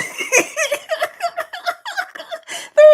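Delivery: high, loud burst of laughter; cracking up. Real, not acted. Cracking up at stickers.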